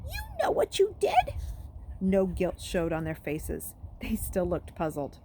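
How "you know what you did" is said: much higher, thin squeak as he tries to sound wronged; a boy whose voice cracks into a squeak; squeaky voice. Acted. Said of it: "His voice came out in a squeak".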